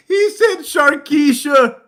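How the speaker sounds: high, loud, slow, cracked with laughter; helpless laughing cry; laughing shriek. Real, not acted. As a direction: wail it while losing it laughing.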